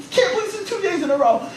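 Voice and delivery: high, laughing exasperation; mock exasperation; exasperated disbelief. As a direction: groan at bad luck, laughing.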